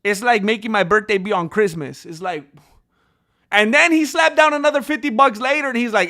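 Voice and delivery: ordinary delivery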